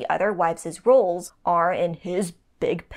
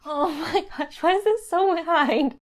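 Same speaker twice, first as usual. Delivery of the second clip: laughing; 8 semitones higher than usual. Real, not acted. Laughing at lagging video.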